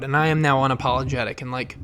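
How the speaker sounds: normal talking voice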